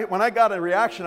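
normal talking voice

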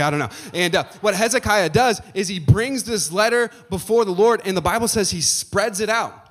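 normal talking voice

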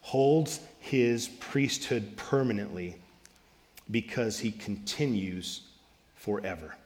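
slow, soft, solemn reading of scripture. Acted. Pastor reading Hebrews to the congregation, slow and soft. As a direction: let each phrase settle, softly and slowly.

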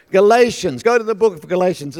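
high, loud call to the congregation; preaching. Real, not acted. Preacher sends congregation to the book of Galatians, forceful preaching. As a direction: announce the scripture book with force.

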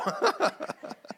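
high airy laugh; breathless laugh. Real, not acted. After his wife's surprising yes, a breathless laugh.

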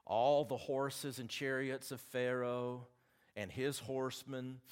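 slow, measured Bible reading with weight on each phrase; deliberate pulpit reading, drawn out; Scripture reading. Acted. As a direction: read it slowly like the King James to the church. Reads Scripture aloud.

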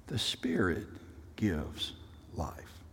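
hushed whispered scripture. Acted. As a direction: lean in and whisper it like a secret. Same verse, hushed this time.

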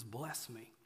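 very soft, low desperate plea; murmured plea; hushed plea. A narrator's reading. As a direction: beg the angel quietly for a blessing. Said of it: "he says".

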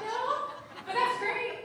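high, loud, laughing enthusiasm; retold gleeful exclamation; delighted reply. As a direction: burst out with amused delight.

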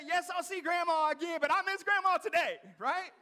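fast, then loud and high with hurt frustration; mad Martha. Acted. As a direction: push back at Jesus, upset and grieving. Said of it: "She's like".

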